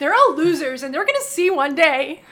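loud mock-defiant declaration; hammed-up loud vow; acted outburst. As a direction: proclaim it loudly as the wronged outsider. Voicing the character's secret feelings.

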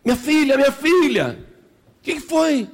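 high, loud, urgent calling; acted motherly panic; alarmed mother. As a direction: call out to your child in alarm. Mother rushes to screaming daughter.

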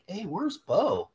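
retold puzzled "hey"; puzzled calling out. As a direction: act out a sudden realization someone is missing. Acted puzzled query in story.

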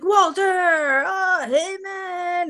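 hammed-up party guest; mock guest greeting. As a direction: overdo the friendly welcome. Guests greeting Walter in story.

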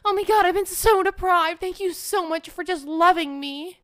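high, loud, over-the-top gratitude; mock gushing. Speaker imagines thanking a future boyfriend, mock gushing. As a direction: gush it, hammed up and desperate.

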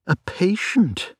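a hint of a gently resigned wife; wife's voice. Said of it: "said she".

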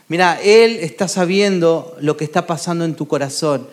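ordinary talk, nothing marked

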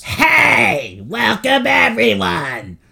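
high, loud woman's voice yelled; man doing a woman's voice; Kia Soul Lady voice. Acted. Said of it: "in his, uh, Kia Soul Lady voice".

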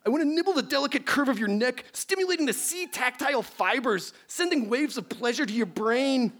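high, hammy aroused professor; overheated nerd, played for laughs; lusty scientist voice. Plays Beekman.

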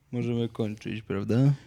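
usual speaking voice

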